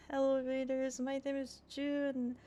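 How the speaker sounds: high, bright, eager welcome; excited greeting. Real, not acted. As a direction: greet them with a thrilled squeal. Greeting raiders while excited.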